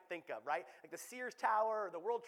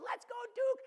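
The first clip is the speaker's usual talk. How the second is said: put-on very high, loud child's cheer; imitated little girl cheering; 8 dB louder than usual. Dad imitating little Lucy cheering for Duke, high excited voice. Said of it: "She's going".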